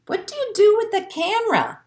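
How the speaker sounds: high, fast curious question; curious penguins. Acted. Other penguins ask the little penguin. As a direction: ask it wide-eyed. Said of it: "the other penguins ask".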